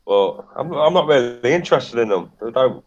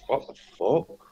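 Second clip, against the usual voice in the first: stunned; 8 dB quieter than usual. Retold or imitated. Friend's stunned reply after a pause.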